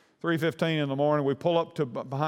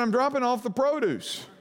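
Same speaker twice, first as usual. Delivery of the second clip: a higher, faster, matter-of-fact voice; produce guy; 10 semitones higher than usual. Retold or imitated. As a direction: play an unbothered delivery man. Produce guy answers the cop.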